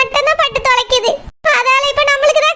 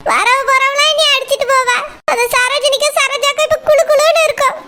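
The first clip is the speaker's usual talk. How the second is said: ordinary talk, nothing marked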